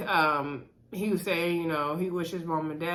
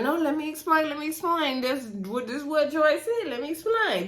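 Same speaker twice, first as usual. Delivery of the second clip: insistent; 7 semitones higher than usual. Retold or imitated. Raina insists during group argument.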